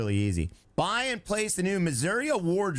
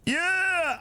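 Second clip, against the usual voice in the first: excited shout; 13 semitones higher than usual. Real, not acted. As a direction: cheer it out. Celebrating finished job.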